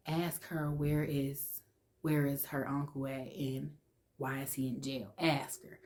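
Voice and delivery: soft, hushed urging; whispering. Retold or imitated. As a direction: hiss the order under your breath. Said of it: "I could hear them whispering".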